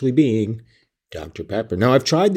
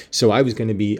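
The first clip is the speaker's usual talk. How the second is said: normal talking voice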